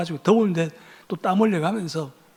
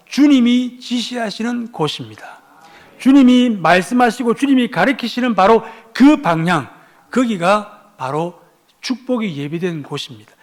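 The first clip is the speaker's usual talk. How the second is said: raised, declamatory sermon voice; emphatic preaching; 4 semitones higher, 5 dB louder, slower than usual. Real, not acted. Preacher tells congregation where obedience lies, emphatic preaching. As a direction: proclaim the key point with force.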